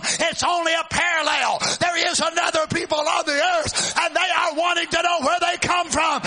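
loud, high, shouting sermon delivery; thundering revival preacher; full-force preaching. Acted. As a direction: preach it to the back row.

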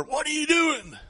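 high, sharp mock challenge from a stranger; angry stranger. Stranger confronting the hunters, high and indignant. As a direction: squeak it out like an outraged busybody.

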